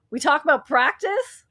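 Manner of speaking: loud, high mock press-conference rant; imitating Iverson's famous practice rant; Allen Iverson meme. Cohost quoting the Iverson meme from chat, hammed-up loud. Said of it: "Someone saying the Allen Iverson meme".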